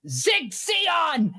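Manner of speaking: high, ringing slogan shout; fanatic salute cry. Acted. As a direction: proclaim it like a zealot saluting. Fanatic salutes and throws grenade.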